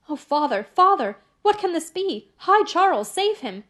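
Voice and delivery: high, loud frightened pleading; panicked cry. A narrator's reading. Lucy crying out to her father in terror, high and loud.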